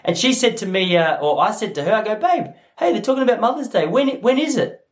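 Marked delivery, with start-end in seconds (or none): 2.2-4.8 high-pitched, casual question to a partner; innocent asking. Retold or imitated. Husband asking his wife about Mother's Day, innocently high-pitched. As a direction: pipe up high, acting out the retold question.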